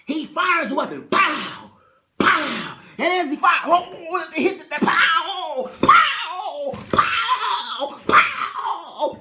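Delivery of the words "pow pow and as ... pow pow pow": loud, high laughing pows; mock gunfire; gunshot pows. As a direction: fire off each pow with a laugh.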